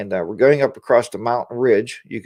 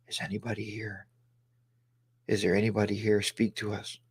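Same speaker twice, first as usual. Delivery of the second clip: hushed, much softer spooky call; calling to ghosts; 4 semitones lower, 13 dB quieter than usual. Retold or imitated. In the prison basement asking ghosts to speak, hushed and eerie. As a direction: softly coax the spirits out. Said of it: "We're like".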